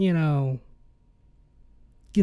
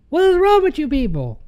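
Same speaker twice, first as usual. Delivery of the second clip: high, loud, fed-up cry at the crowd; exasperated outburst; 9 semitones higher, 4 dB louder than usual. Real, not acted. Ranting at Tide Pod challengers, exasperated high shout. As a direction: throw up your hands and yell it.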